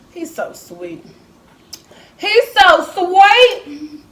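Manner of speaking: very slow, loud and high He's so sweet; drawn-out doting gush. Real, not acted. Mother gushing over her baby son, loud and drawn out.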